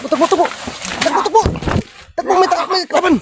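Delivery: high urgent calls of run; urgent excited shouting. Real, not acted. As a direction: yell run, run in a rush.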